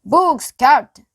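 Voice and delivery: high loud shout from above. A narrator's reading. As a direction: yell down to the captain. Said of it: "Pinky shouted down".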